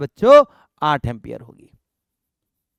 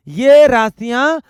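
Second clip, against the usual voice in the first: "ये" stretched, high and loud; slow, raised emphasis starting on "ये"; stress on ये; 5 semitones higher, 7 dB louder, slower than usual. Real, not acted. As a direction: punch "ये" hard and hold it, pointing out these ones. Pointing students to these quantities.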